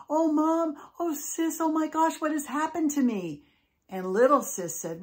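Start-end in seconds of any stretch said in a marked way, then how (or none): 0.1-3.4 high-pitched, acted storybook wailing; tearful wail. Molly cries to her family over her weight, tearful wail. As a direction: wail it to Mom and Sis in mock tears. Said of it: "She started crying".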